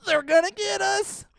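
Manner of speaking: high, very slow Shaggy voice; Shaggy impression. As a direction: drawl it like scared Shaggy. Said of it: "what else does he say?".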